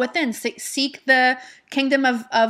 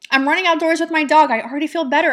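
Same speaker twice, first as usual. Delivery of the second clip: upbeat affirmation; 5 semitones higher, 5 dB louder, faster than usual. Shown as a demonstration. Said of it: "What can I focus on to feel good".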